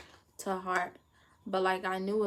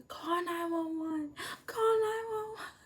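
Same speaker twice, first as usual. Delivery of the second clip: crying plea; 10 semitones higher than usual. Retold or imitated. Student sobs at the nurse's staff, very high. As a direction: beg through sobs.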